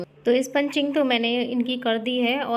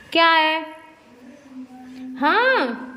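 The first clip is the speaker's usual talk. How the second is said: high, loud short questions; loud prompting; 6 semitones higher, 5 dB louder, much slower than usual. Real, not acted. Caregiver prompting crying papa, loud high questioning. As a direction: call out for a response.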